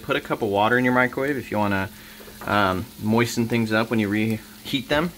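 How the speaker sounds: ordinary talk, nothing marked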